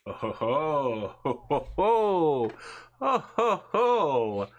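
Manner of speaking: slow booming villain chuckle. Acted. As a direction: gloat with a big slow ho ho ho. Dracula player gloating at plan.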